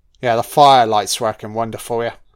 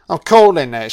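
usual speaking voice

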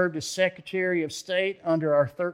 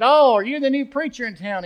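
mocking greeting; 9 semitones higher, 11 dB louder than usual. Scoffer greets new preacher.

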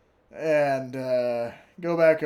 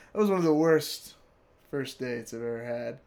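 usual speaking voice

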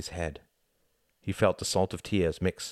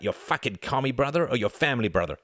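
hostile, higher-pitched challenge; spitting scorn; 5 semitones higher than usual. A narrator's reading. Scowling man interrogating Tom about his brother, spitting it. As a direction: spit the question out with contempt. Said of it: "Another scowled and spat".

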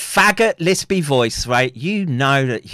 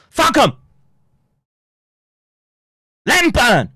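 high, raised-voice curse; angry dismissal; 8 semitones higher, 4 dB louder than usual. Real, not acted. Streamer dismissing those who talk exosomes, angry shout. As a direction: burst out with contempt.

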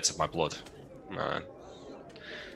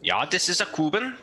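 fake German; 11 semitones higher than usual. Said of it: "Give me your worst fake German".

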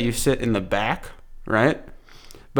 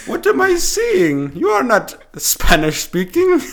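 high, slow put-on Finnish accent; incredulous hammed-up Finnish, drawn out; mock Finnish accent; 11 semitones higher, 4 dB louder, slower than usual. Finnish man doubting his colleague's Spanish, in a mock Finnish accent. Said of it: "I'll be a fellow Finnish man".

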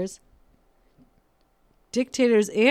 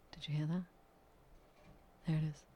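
whisper; 4 semitones lower, 15 dB quieter, much faster than usual. Real, not acted. Noticing painters outside.

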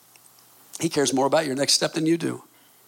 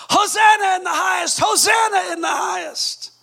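high, exultant acted shout; crying out; 13 semitones higher, 5 dB louder than usual. Preacher voicing the crowd as Messiah arrives, crying out. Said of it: "But they were crying out".

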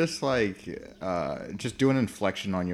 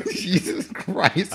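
squealing laugh, then a slow, stretched out Jesus Christ; laughing disbelief; 10 semitones higher, much slower than usual. Real, not acted. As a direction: laugh high, then drag the words out. Confused by a friend's claim.

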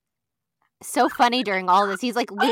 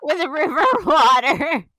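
laughing wail; 7 semitones higher, 6 dB louder than usual. Real, not acted. Mock wail while laughing.

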